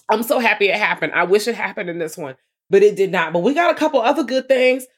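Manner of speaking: usual speaking voice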